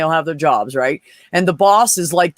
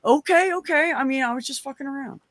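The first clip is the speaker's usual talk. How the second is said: sheepish boss backing down; 7 semitones higher, 5 dB quieter than usual. Retold or imitated. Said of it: "he said".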